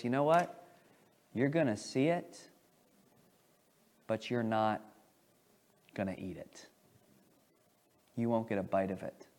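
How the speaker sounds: low, quiet and foreboding; ominous warning. Retold or imitated. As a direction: let the doom sink in softly. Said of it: "those ominous words from Elisha".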